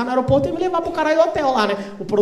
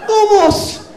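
high, louder European Portuguese impression; emphatic comic European Portuguese, pitched right up; insistent mock European Portuguese; 10 semitones higher, 4 dB louder, slower than usual. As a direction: insist in a put-on Portugal accent. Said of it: "só entende se falar assim".

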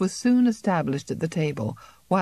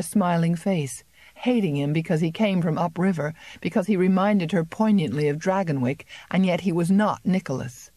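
ordinary delivery, nothing marked

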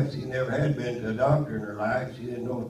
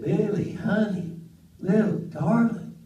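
lightly voiced sweet aunt, higher; 7 semitones higher than usual. Aunt sweet-talking her nephew, higher, lightly voiced. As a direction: coo it like a doting old aunt. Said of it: "she is so sweet".

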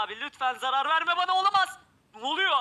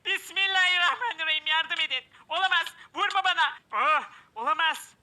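panicked high yelps, louder; mock panic; 5 semitones higher, 4 dB louder than usual. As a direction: yelp for help as the mob attacks. Attacked by mob in game. (abartılı panik çığlıkları)

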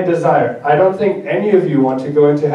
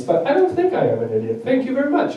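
polite brush-off; 7 semitones higher, 5 dB quieter than usual. Retold or imitated. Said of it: "the best way would be to say".

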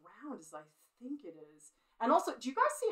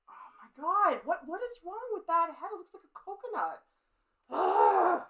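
higher, louder mock dismay at her drawing; mock horror; 9 semitones higher, 10 dB louder than usual. Real, not acted. Artist to her viewers about the coconut head, loud mock dismay.

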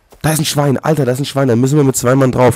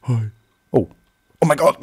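usual speaking voice

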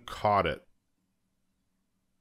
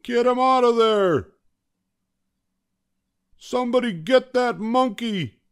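high, urgent yelling; umpire's yell; 5 dB louder than usual. A narrator's reading. Umpire ordering players after the monkey, yelling. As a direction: yell orders across the field. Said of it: "yelled the umpire".

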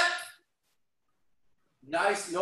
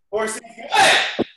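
shouted kiai; 3 semitones higher, 8 dB louder than usual. Real, not acted. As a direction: bark the stance command. Stance command kiai.

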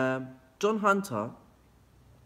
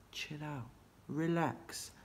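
much softer, soothing himself down; quiet chill out; 3 semitones higher, 8 dB quieter, slower than usual. Real, not acted. Teacher calming himself, softly saying relax. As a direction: ease off and calm down softly. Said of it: "you're making me very wrinkly".

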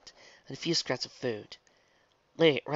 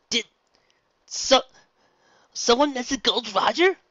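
excited shout; 12 semitones higher, 6 dB louder than usual. Acted. Luffy wakes excited.

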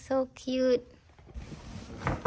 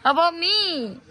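whiny pleading; 6 semitones higher than usual. Pretend. Birthday girl begging for hug.

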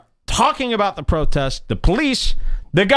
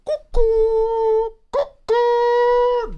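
high, slow, sing-song cuckoo; mock cuckoo voice; much slower than usual. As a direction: stretch cuckoo out way up high like a loony bird. Calls people crazy in a mock voice.

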